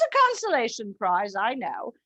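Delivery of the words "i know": ordinary talk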